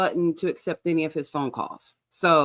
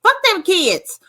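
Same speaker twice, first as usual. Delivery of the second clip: mock reckless Brooke; 3 semitones higher, 7 dB louder than usual. Voicing Brooke's reckless thinking.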